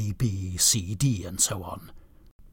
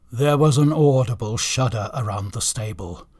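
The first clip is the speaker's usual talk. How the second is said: ordinary delivery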